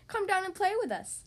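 calling out. A narrator's reading. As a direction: call playfully down to a playmate. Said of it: "he called".

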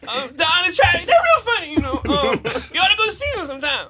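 high strained yelping voice; Bobcat Goldthwait. Acted. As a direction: shout it, cracking and nervous. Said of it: "a quick Bobcat Goldthwait".

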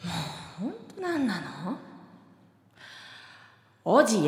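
tsundere. Acted. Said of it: "ツンデレで言っていただきましょう。".

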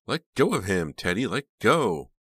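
high, loud pleading shout; shrill pleas. A narrator's reading. Jade pleading with Teddy to release Chuck, shrill shout. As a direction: scream at him to let go. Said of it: "Jade's shrill pleas".

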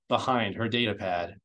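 ordinary delivery, nothing marked

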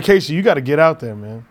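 normal talking voice

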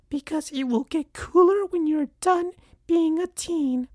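earnest plea in high falsetto; put-on mother voice, pleading sorrowfully; very sad, meaning it. A narrator's reading. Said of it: "in a very sad and meaning it way".

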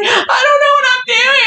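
high, singsong mock wail; panicked niece. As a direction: imitate a helpless, panicking niece. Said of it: "she's like".

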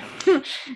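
short high awkward giggle; awkward laugh. Real, not acted. Embarrassed cohost laughing at herself.

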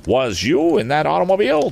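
demanding farmer. Acted. Farmer questioning Tom about the auto, high loud demand. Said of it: "demanded the farmer".

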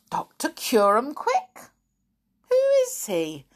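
rising to very high on who is he; puzzled question. Acted. Sammy playing innocent to Flip, puzzled. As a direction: act baffled, innocently.

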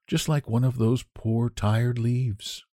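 quiet, tired invalid voice; sick Johnsy, quietly waiting for the last leaf; weary sick girl. Acted. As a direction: speak it softly, drained of strength. Johnsy's voice in the story.

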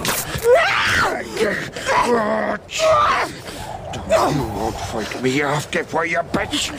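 high strained snarl of a brute; snarling attacker. Acted. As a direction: force her, spitting with rage. Villain grapples, furious.

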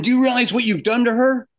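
mock yell of an appalled boss; retold yelling. Boss scolding animator who lost his cool, mock yelling. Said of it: "he's gonna yell at me".

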